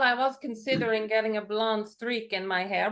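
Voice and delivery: normal talking voice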